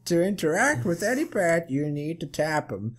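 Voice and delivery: game grandmother, pleasant. Acted. Grandmother explaining how to interact with pets, high character voice. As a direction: put on a pleasant older lady.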